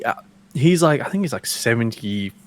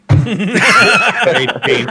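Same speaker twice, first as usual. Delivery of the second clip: laughing mock scoff; 10 semitones higher, 5 dB louder than usual. George doubting his age.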